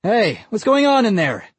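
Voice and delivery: a neighbor shouting in alarm; shout from outside. Acted. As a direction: call through the garage door. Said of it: "a shout from outside".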